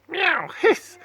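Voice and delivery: very high meow then hiss; high cat hiss. Acted. Cats scratching at the dog.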